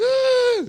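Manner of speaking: loud, very high held "aah"; piercing mock-crowd cheer; high crowd scream. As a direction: shriek like an awestruck crowd. Acts out crowd reacting to entrance.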